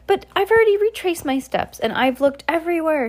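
high whiny complaint; complaining. A narrator's reading. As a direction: protest with frustration. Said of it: "she complained".

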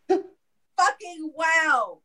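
ordinary delivery

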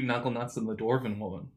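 ordinary delivery

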